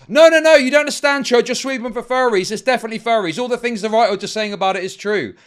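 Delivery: high, loud imitation of outraged objectors; mock indignant protest. Streamer mimicking followers who object to his doubts, mock-indignant. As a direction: burst in protesting, hammed-up and insistent.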